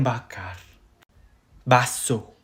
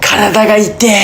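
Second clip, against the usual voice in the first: higher-pitched mock ache; achy and tired; 4 semitones higher, faster than usual. Japanese phrase for an aching body, put-on achy complaint. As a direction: complain that your body hurts. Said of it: "Kalau badan kamu capek".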